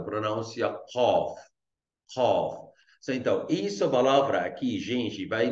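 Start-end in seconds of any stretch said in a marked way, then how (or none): 0.9-2.7 Qaf with the Q made deep in the throat; slow Qaf with a guttural Q; throaty Arabic Q. Shown as a demonstration. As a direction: draw out Qaf, starting from the back of the throat. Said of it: "pronuncia como só de garganta".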